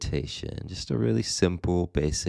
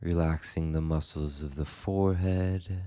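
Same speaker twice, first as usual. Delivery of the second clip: guided meditation; 3 dB quieter than usual. Acted. Meditation teacher guiding listeners into the body and breath, calm guided meditation. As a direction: guide them gently, leaving space after each phrase.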